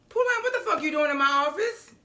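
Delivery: high, sharp mock-mom voice, pitched well up; mama's angry demand. Recapping a show, mimics Mama catching Pulaine in her office. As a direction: imitate a mother catching someone in her office. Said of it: "Mama walks in her office and is like".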